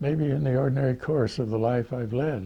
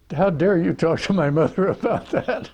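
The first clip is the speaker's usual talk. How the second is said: higher, louder indignant protest; outraged kid; 8 semitones higher, 6 dB louder than usual. Retold or imitated. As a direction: defend your mother, offended. Billy outraged at his friend.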